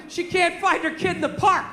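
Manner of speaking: usual speaking voice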